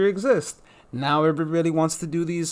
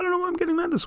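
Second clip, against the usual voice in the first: the line pitched way up high; much faster than usual. Real, not acted. Vlogger questioning own anger, very high-pitched. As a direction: squeak it out in a very high voice.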